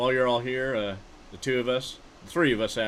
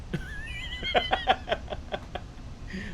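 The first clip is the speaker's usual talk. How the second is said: hearty laugh. Real, not acted. Laughing at his old insult to his friend, real laughter. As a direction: crack up at the memory.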